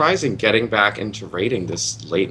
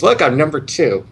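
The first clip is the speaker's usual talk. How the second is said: higher-pitched pretend brag; mock boast; 6 semitones higher, 4 dB louder than usual. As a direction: play at being proudly number two. Acts out quote of meter boaster.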